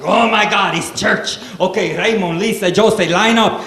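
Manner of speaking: high, loud Cuban dad in a tizzy; hammed-up Cuban-accented fluster; mock hissy fit. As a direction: imitate dad frantically rounding up the kids. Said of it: "fucked up every Sunday about church".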